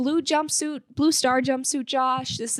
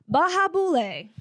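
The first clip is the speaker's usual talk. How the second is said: higher, lilting toast; happy expression; 5 semitones higher than usual. Retold or imitated. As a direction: call it out like a cheerful toast before a shot. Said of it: "a very happy expression".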